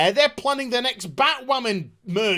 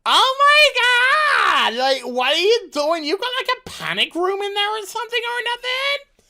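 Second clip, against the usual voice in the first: put-on much higher, over-the-top girly voice; squeaky Mighty Mouth; 11 semitones higher, 4 dB louder than usual. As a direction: stretch the oh my God high and loud. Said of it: "And she's just like".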